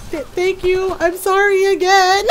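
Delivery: high, stammering mock-mortified thanks; flustered apology. Embarrassed telemarketer ending the call, high flustered apology. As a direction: imitate a flustered caller fleeing in embarrassment.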